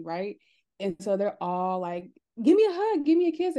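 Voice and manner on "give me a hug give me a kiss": put-on high, loud sing-song baby talk; cooing relatives. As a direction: coo at the baby, demanding a hug. Relatives demanding hugs from child.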